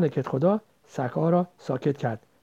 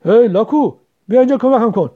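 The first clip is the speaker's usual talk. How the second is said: very loud, higher bossy order; imperious shout; 7 semitones higher, 11 dB louder than usual. A narrator's reading. Headman's son ordering girl to help, imperious shout. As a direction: order her over roughly. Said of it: "آمرانه".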